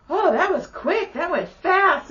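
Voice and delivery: put-on high, chirpy just-woke-up kid voice; imitating her son. Son waking up after the drive, high innocent voice. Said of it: "Little motherfucker wakes up and goes".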